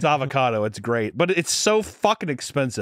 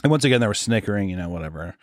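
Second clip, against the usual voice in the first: normal talking voice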